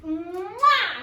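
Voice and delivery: long playful smooch; kiss noise. Acted. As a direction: stretch it out into a kiss. Repeats the demonstrated kiss.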